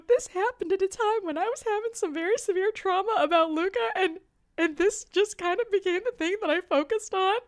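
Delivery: high tearful confession; retelling a teary plea; crying. Retold or imitated. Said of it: "And I started crying".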